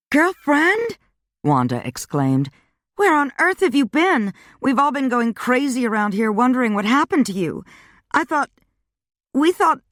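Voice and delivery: put-on high, excited girlfriend voice; a bubbly friend bursting with questions; Wanda voiced high. A narrator's reading.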